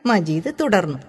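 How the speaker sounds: ordinary delivery, nothing marked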